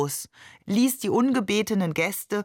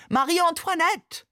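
very high, slow, hammed-up cry; theatrical call; 10 semitones higher than usual. A narrator's reading. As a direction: cry out her nickname like an operetta hero. (theatralisch hoch und gedehnt gerufen)

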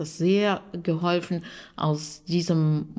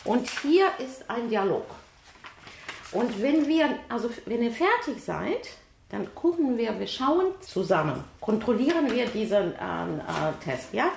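higher, bright-toned greeting to the students; classroom teacher voice; 5 semitones higher than usual. Real, not acted. Teacher welcoming her Ukrainian students to a test, upbeat classroom voice.

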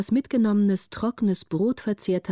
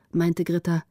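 ordinary delivery, nothing marked

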